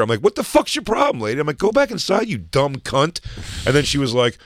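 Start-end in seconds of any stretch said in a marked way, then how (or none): 0.2-1.4 high-pitched retold outrage; indignant challenge. Fan confronting the security lady, retold indignant challenge. 1.6-3.2 high retold dismissal; angry insult. Fan sending the security lady away, retold angry insult.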